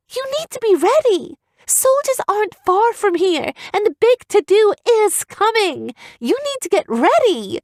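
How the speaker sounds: put-on high young heroine pleading with the crowd; urgent girl's warning. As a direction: warn them urgently, high. Evian warns the crowd.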